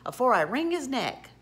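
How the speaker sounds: irritated character warning; growled threat. A narrator's reading. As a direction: threaten crossly with a rising push. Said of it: "growled Tom".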